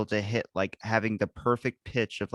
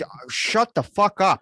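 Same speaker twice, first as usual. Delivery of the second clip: raised, drawn-out retold snap back; hammed-up irritated comeback; mock angry retort; 9 semitones higher, 5 dB louder than usual. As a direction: hit back in mock exasperation, high and loud. Acts out angry retort to stranger.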